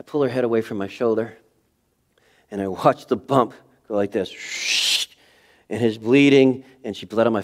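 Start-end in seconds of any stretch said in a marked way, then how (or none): none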